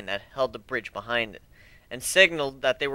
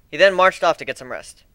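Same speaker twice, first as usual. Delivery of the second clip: ordinary delivery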